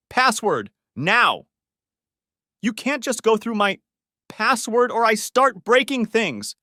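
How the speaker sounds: high, loud, slow threatening shout; furious demand. Acted. Husband shouting at his wife for her phone password, furious. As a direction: bark the order at her, menacing.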